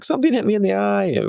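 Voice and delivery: put-on high-pitched, hurried panicked kid; imitated freak-out. Daughter to dad after being hit in the eye, mimicked panic. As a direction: mimic an upset daughter, voice jumping up. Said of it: "She freaked out".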